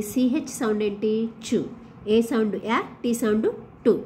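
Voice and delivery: ordinary delivery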